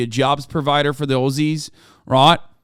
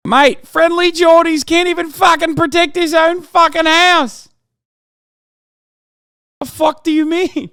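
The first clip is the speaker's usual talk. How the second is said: high, loud, incredulous yelling; shouting, voice cracking high with outrage; exasperated shout; 13 semitones higher, 5 dB louder than usual. Real, not acted. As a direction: blow up in disbelief at the chat. Incredulous rant about Friendly Geordies.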